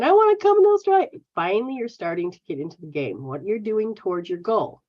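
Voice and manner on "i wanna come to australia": much higher, louder, fast mock whine; whiny wish. Mocking viewers who want to come to Australia, high whine. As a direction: squeal it like a wishful viewer. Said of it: "you keep saying".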